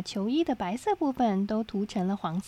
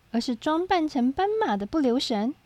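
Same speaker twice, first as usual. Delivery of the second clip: ordinary delivery, nothing marked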